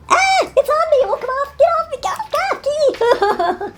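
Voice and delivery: high, frantic squeals of panic; panicked. Real, not acted. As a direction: shriek it off in a real panic. Panicking at something stuck on her.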